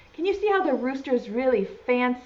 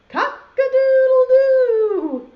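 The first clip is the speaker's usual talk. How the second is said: loud, high, drawn-out crow; rooster crow; 13 semitones higher, 8 dB louder, slower than usual. Acted. Teacher leading children in the rooster's call, crowing. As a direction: crow it slowly and loudly like a rooster. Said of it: "then everyone has to say".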